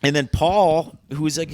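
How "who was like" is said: normal talking voice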